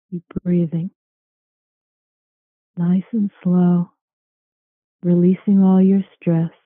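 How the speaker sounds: slow, calm meditation voice; guided meditation. Acted. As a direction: guide them into calm, slowly. Leads a guided relaxation.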